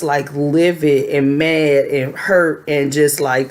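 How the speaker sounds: normal talking voice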